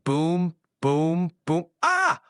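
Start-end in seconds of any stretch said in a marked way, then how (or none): 1.8-2.2 sudden high yelp of fright; startled scream. Acted. Mock shriek at a ghost popping out of a cookie.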